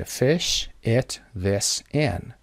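"فش، it, this, in" with short lax vowels near "e"; correct short vowel. Shown as a demonstration. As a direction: model each of "فش، it, this, in" with a short open vowel, not "ee". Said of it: "پس یادتون باشه". («فش، it, this, in» با صدای کوتاه، نزدیک به اِ)

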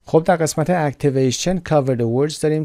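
ordinary talk, nothing marked

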